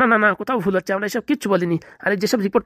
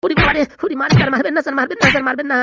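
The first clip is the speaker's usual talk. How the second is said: panicked pleading; 5 semitones higher, 4 dB louder than usual. Acted. Doctor begging police not to hit.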